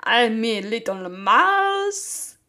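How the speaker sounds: very slow, loud put-on French accent; a hammed-up French mouse, stretching every word. Mouse patient shows his therapist a French voice, loud slow mock French accent. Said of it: "very good French".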